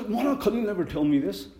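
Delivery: high, loud indignant protest. Retold or imitated. Muazzin protesting to the imam, high indignant voice. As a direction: protest like you've been wronged. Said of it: "He said".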